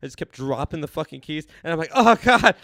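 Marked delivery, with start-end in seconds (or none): 1.9-2.5 loud, high burst of despair; exasperated cry. Retold or imitated. Kept dropping the keys, loud desperate exclamation. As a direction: cry out at cruel bad luck.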